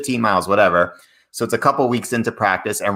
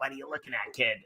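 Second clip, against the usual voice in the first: much softer, higher Boston challenge; Boston accent; 5 semitones higher, 15 dB quieter, faster than usual. Retold or imitated. Boston kids rejecting friendship offer, soft accented challenge. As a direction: imitate a Boston tough quietly. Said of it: "they're like".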